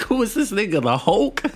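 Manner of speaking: high-pitched, amused disbelief; incredulous. Retold or imitated. Viewer retelling his reaction to Knox's strength, incredulous. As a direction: ask it way up high, astonished.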